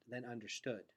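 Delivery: ordinary delivery, nothing marked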